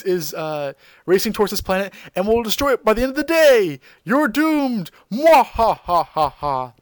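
hammed-up evil villain reveal; mad scientist. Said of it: "yanks his collar and says".

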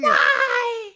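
high, loud wailed impression; shrieky imitation with laughter; mock scream. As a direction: scream it like a hammed-up reality star. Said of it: "running and screaming".

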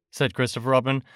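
plain reading voice

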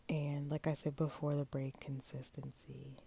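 hushed, secretive whisper. Real, not acted. Sharing a secret with listeners, whispered. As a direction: lean in and whisper the confession. Confessing secret after the break.

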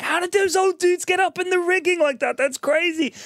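high, incredulous exclaim; mock amazement. Host retelling his own amazement in a high incredulous voice. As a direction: marvel at the old men climbing. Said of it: "And I'm like".

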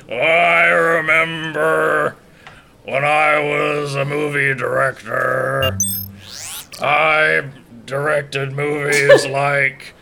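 put-on slow, dreamy old robot; hammed-up senile machine; AI Brooks voice. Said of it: "I am A I Brooks".

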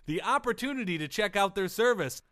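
bright, high-pitched sponsor read; upbeat announcer selling the ad. Acted. Reads sponsor ad.

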